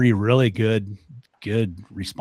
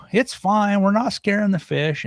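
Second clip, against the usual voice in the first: hollering back; 12 semitones higher, 3 dB louder, slower than usual. Retold or imitated. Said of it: "she hollered something like".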